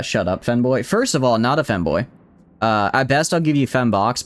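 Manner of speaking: ordinary talk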